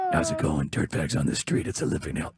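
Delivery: very low, husky gravel growl. Retold or imitated. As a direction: growl it like a joyless Batman.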